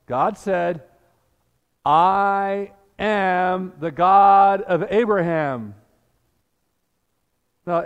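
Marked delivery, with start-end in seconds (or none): 1.9-5.7 slow, louder, stress on God; booming divine declaration; proclaiming. Retold or imitated. Jesus quotes God, proclaiming.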